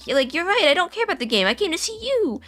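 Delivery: high-pitched wounded protest; Vinny, upset. Acted. Vinny answering his best friend's rejection, acted upset. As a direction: plead it high, acting hurt and upset. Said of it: "start making Vinny kind of upset".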